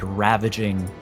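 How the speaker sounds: ordinary talk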